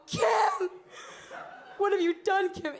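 high, loud melodramatic wail; anguished stage cry. Retold or imitated. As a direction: cry out over the body, hammed up. Acts castmate crying over the body.

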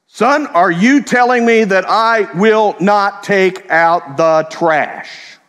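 slow, loud drill sergeant bark. Retold or imitated. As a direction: drag each word out like a drill sergeant. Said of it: "to the drill sergeant voice".

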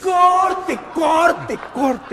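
loud director's cut, fading by the third; yelling cut. Real, not acted. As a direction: stop the take with a sharp call. Director stops the bad take. (director grita corte, cada vez más suave)

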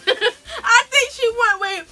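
laughing through the words; giggling, can't hold it in; trying not to laugh. Real, not acted. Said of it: "Stop making me laugh".

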